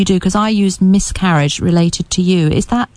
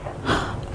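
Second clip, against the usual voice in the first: sharp shocked intake of breath; gasp; 13 dB quieter than usual. Real, not acted. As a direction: gasp in surprise. Shocked by sudden infant death.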